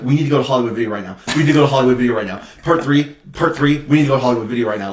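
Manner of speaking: put-on rushed, breathless pleading as an impatient kid; very fast mock childish urgency; excited kid. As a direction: rattle it off fast like a kid begging a parent. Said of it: "being a kid and being like".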